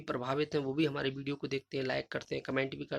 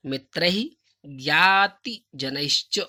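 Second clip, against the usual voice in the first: slow loud recitation; 6 dB louder, much slower than usual. Shown as a demonstration. Teacher recites the answer slowly and loudly to model pronunciation. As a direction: read the Sanskrit answer slowly and loudly, every word separate. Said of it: "उच्चारण के लिए हम आपको ये उच्चारण कर रहे हैं".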